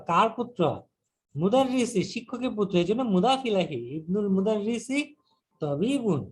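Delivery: usual speaking voice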